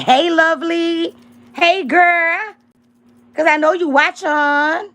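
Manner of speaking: high, drawn-out, taunting hello; mock-sweet greeting. Real, not acted. To a rival lurking on the live stream, mock-sweet. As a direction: sing it sweetly at someone you dislike.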